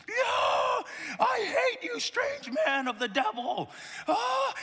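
high, drawn-out mock shriek; hammed-up wailing tirade; screaming baby. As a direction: scream it as an outraged baby, stretching the words. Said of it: "And she's just".

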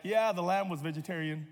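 ordinary talk

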